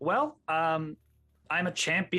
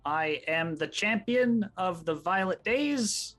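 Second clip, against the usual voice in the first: slow, raised-pitch heroic announcement; grand proclamation; 6 semitones higher, slower than usual. Acted. Fighter introducing himself to a watching crowd, grandly proclaiming. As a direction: proclaim each title with slow pomp.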